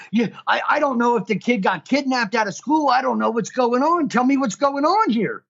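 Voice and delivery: mock yelling. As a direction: pitch it way up, frantic worried parent. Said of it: "So I'm screaming, I'm yelling at this dispatcher".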